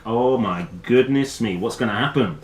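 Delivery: plain reading voice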